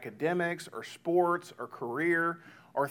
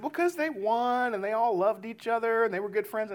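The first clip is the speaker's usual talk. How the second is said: imitated young child; 6 semitones higher than usual. Kids explain why the movie was happy, imitated child voice. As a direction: imitate a little kid listing reasons.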